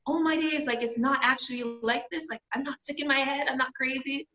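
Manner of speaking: gushing with relief that someone relates; relieved opening up. Retold or imitated. A friend, sharing she went through it, lifted and eager. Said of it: "you're like".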